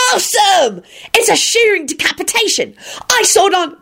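put-on high, thrilled child's yell; happy yell. A narrator's reading. Boy thrilled by decapitation, yelling happily. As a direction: yell it with gleeful awe. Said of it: "Timothy yelled happily".

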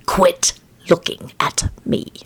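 slow, whispered hiss; hissed warning. A narrator's reading. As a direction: hiss each word at a pet bird. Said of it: "he hissed at the bird".

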